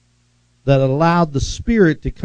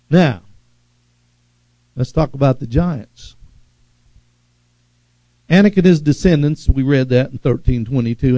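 normal talking voice

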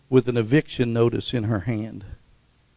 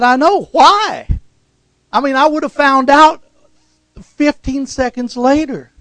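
high, loud, exasperated I know why; exasperated retort; 10 semitones higher, 8 dB louder than usual. Retold or imitated. Preacher retelling his reply to his mom, high and exasperated. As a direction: throw it back at mom, pitched up and loud.

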